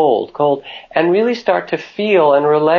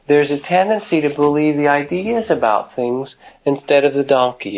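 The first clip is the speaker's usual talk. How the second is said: ordinary delivery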